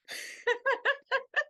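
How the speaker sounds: high delighted laugh. Real, not acted. Recalling lighting the stranger's cigarette, delighted laughter.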